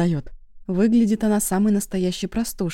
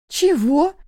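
high, loud burst of surprise; startled exclamation; 10 semitones higher, 6 dB louder than usual. A narrator's reading. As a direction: exclaim it higher and louder, taken aback. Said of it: "воскликнула она".

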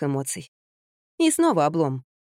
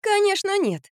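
high-pitched quick denial; blurting out; 13 semitones higher, slower than usual. A narrator's reading. Narrator hastily reassures the sick man, blurting it out. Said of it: "выпалила я".

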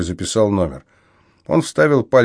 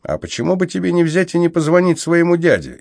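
sly needling question, pitched up; teasing jab; 9 semitones higher than usual. A narrator's reading. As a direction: poke at him with a sly dig. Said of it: "с эдакой подковыркой в голосе".

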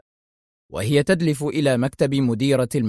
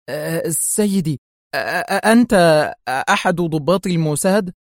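halting, tearful, higher stammer; terrified; 3 semitones higher, 3 dB louder than usual. A narrator's reading. Panicked principal asks intruder who he is, terrified. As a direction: stammer the question as if near fainting. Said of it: "تفقد وعيها ذعرا وهي تسأله".

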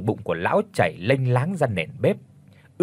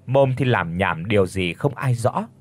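ordinary delivery

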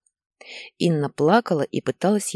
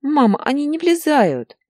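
very high plaintive wail; upset, breathless child; 12 semitones higher, 6 dB louder than usual. A narrator's reading. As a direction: whine up to your mother like a small boy. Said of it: "задохнулся Илья". (высокий жалобный детский голос)

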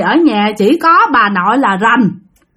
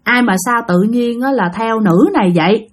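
normal talking voice

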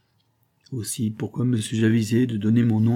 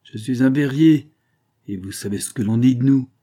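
sneering; 4 semitones higher, 7 dB louder than usual. A narrator's reading. Husband taunting his wife about his trade, sneering. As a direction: draw it out with a sneer. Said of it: "Il recommença son ricanement familier".

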